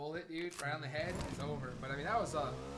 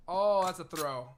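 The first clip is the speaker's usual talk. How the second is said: loud slow reaction; 10 dB louder, slower than usual. Real, not acted. Co-caster reacts to a throw.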